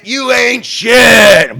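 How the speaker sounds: slow booming shout of contempt; bully's shout. Pretend. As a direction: stretch it out like a movie bully taunting. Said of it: "when it comes time to say it".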